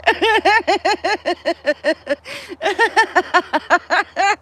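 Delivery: rising breathless giggle fit; real crying-with-laughter fit; helpless laughter. Real, not acted. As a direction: laugh till it hurts. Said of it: "I'm crying".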